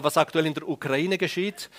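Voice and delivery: normal talking voice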